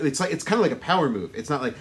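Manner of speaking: ordinary delivery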